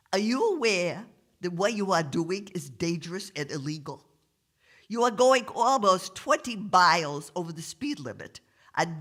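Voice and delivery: stuffed-up, officious cop voice; New York cop with cold. Acted. Cop lists the offences, stuffed-up New York officialese. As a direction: lecture them through a blocked nose. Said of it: "sounds like he's got a terrible cold".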